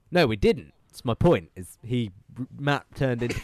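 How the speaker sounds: ordinary talk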